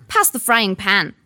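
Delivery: put-on bossy child. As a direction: demand it rudely. Spoiled son demanding.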